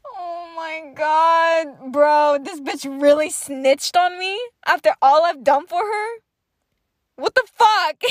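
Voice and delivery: hammed-up indignation; retold outraged disbelief. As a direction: act betrayed, rising to an exasperated cry. Acted outrage at being snitched on.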